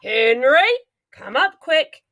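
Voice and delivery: high urgent call; yell. A narrator's reading. Mummy calls daddy to the bathroom, yelling. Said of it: "And she yells".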